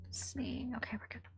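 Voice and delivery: a quick whispered aside. Real, not acted. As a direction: barely breathe it to yourself while checking. Quick aside while checking stream.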